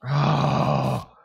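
loud, high drawn-out oh; alarmed cry. Real, not acted. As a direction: cry out at near disaster. About to die in game.

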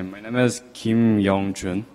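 ordinary talk

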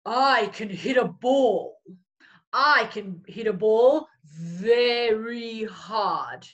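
a big friendly lion boasting. Acted. Lion character line in story.

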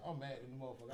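normal talking voice

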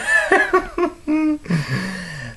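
high villain giggle-cackle; shrieky mad-clown laughter, trailing off; Joker laugh. Acted. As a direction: cackle high at your own cruel joke. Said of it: "my Mark Hamill impression".

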